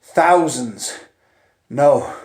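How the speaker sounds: ordinary delivery, nothing marked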